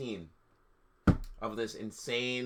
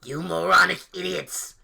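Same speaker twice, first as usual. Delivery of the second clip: mock angry villain shout, louder; hammed-up angsty yell; a hint of a furious dark lord; 6 semitones higher, 9 dB louder than usual. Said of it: "he shouted angstily".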